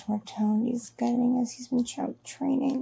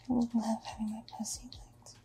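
whispering. Acted. Intimate whispered fantasy.

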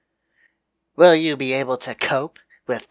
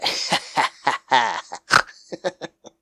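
gleeful laugh. Acted. Villain laugh after threat.

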